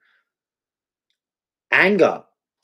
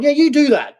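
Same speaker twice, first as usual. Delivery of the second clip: much higher, goading threat; menacing inner voice; 12 semitones higher than usual. Acted. Inner voice holding a gun to his sad side, menacing. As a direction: dare them in a high, menacing voice. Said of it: "it's got a gun to the, the sad side".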